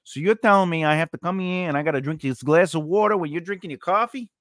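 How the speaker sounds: hammed-up Brooklyn, rising into an incredulous question; Brooklyn accent. Shown as a demonstration. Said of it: "having Brooklyn accent in parentheses".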